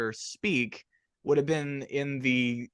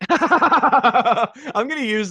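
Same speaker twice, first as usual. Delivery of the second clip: laughing; 10 semitones higher, 7 dB louder, much slower than usual. Real, not acted. Laughs at the word Bowserese.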